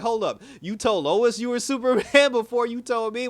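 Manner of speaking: high, loud, disbelieving complaint; outraged friend, laughing through it; hammed-up betrayed buddy; indignant. Jimmy indignant at Clark.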